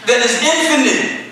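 high, climactic pulpit exhortation; full-force preaching. Real, not acted. Preacher urging the church to be passionate, climactic preaching. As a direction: build to a ringing, urgent plea.

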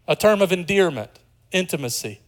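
ordinary delivery

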